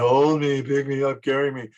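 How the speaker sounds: mock whiny toddler plea; lightly voiced tired little kid begging to be carried. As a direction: play a worn-out two-year-old. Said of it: "they get tired".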